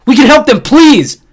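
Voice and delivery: high, loud plea; pleading shout. Acted. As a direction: beg at full voice. Jordan pleading loudly.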